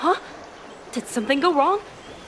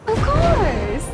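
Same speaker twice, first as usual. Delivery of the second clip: delighted; 6 semitones higher, 5 dB louder than usual. Acted. Wife suddenly delighted.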